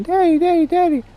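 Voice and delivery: put-on very high, loud child squeal of daddy; screaming kid. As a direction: shriek it like an excited little kid. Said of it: "kid comes out screaming".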